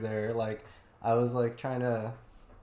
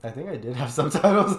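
laughing; 7 dB louder than usual. Real, not acted. Laughing admission.

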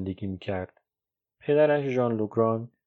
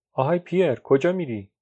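high, louder friendly hail; calling out; 5 semitones higher, 5 dB louder than usual. Acted. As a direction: hail a friend going past. Friend hails Pierre.

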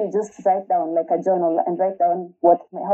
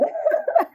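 quick high-pitched giggle; a bright little burst of laughter; embarrassed giggle at herself. Real, not acted. Laughing about crying at falls.

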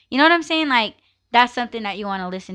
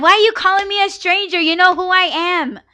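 indignant outburst; 10 semitones higher, 7 dB louder than usual. Retold or imitated. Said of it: "It's like".

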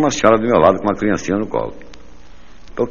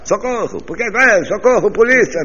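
much higher mock cries; mock screams for help; 14 semitones higher than usual. Woman in the cinema mock screaming for the police. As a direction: shriek for the police, hammed-up. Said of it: "ela começou a gritar".

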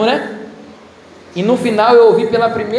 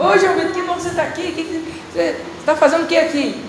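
higher, excited little sister voice; bubbly little sister; 8 semitones higher than usual. Retold or imitated. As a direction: chirp it like a happy kid sister. Irmã mais nova saltitante. (irmã caçula saltitante e feliz, voz mais aguda)

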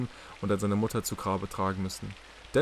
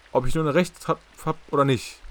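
louder and higher, indignant; insistent; 5 semitones higher, 9 dB louder than usual. Acted. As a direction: demand it, voice raised. Catherine insists on answers. (empört und laut, mit erhobener Stimme)

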